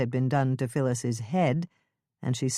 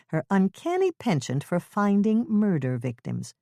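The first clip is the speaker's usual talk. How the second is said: ordinary delivery, nothing marked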